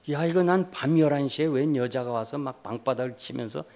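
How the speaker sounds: ordinary talk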